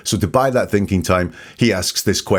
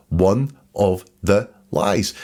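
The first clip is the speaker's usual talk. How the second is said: slow emphasis; much slower than usual. Shown as a demonstration. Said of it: "He talks about them as a very concrete, uh, concept".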